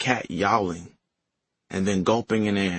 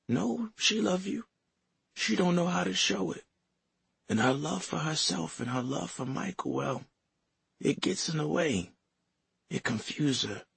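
soft, high grandmother voice; 8 semitones higher, 6 dB quieter than usual. Acted.

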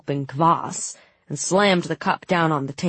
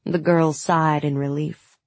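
ordinary delivery, nothing marked

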